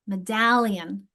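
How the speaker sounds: slow "Medallion" with DAL drawn out; "Medallion", stressed me-DAL-lion; second-syllable stress. Shown as a demonstration. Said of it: "it's in the second syllable".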